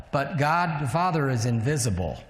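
usual speaking voice

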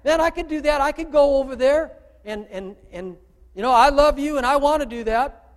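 high, loud eager volunteer; eager follower. Retold or imitated. Followers telling Jesus they can do it, eager and high. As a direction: burst out like a keen disciple. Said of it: "then the followers say".

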